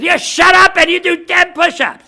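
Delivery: high, full-force shout; barked command. Acted. Trainer to exhausted client, shouted barking order. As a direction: bellow like a drill instructor.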